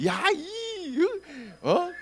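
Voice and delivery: high, strained mock yell; 버럭 소리 지르기. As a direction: act out an angry yell you held back. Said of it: "저렇게 소리를 질렀을 거예요". (높게 내지르는 화난 고함 흉내)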